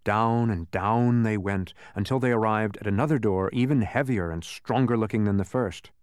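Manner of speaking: usual speaking voice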